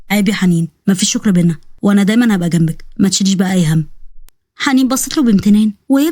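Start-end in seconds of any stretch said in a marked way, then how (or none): 0.1-3.9 low, steady man's voice; tender lowered reassurance; Osman, reassuring protector. Acted. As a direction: brush off thanks and promise support.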